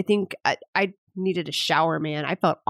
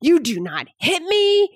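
high, louder, slow scolding shout; retold yell; 10 semitones higher, 3 dB louder, slower than usual. Mom reenacting yelling at her son for hitting, slow firm shout. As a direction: lay down the law. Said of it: "yelled at him".

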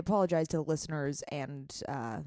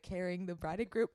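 ordinary talk

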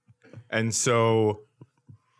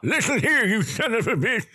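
put-on grumpy old puppet; 11 semitones higher than usual. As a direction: bark it like a cranky old puppet. Imitating Walter puppet.

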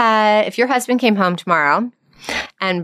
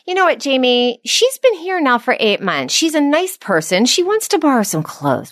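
scolding; 7 semitones higher than usual. Shown as a demonstration. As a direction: brush off her feelings and scold. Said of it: "and I said".

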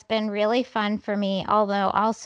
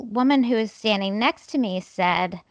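ordinary talk, nothing marked

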